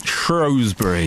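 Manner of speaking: "Shrewsbury" drawn out slowly as SHROWZ-bury; slow insistent Shrewsbury. Shown as a demonstration. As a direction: stubbornly stretch "Shrewsbury" with "shrowz". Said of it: "they may say Shrewsbury".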